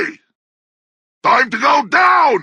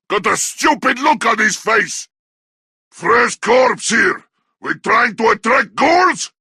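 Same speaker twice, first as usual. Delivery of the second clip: ordinary talk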